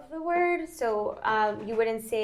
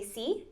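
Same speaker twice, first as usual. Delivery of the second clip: "sí" with a rising end; questioning tune; 3 semitones higher, 5 dB quieter than usual. Shown as a demonstration. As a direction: lift "sí" into a question. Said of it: "you're making it a question".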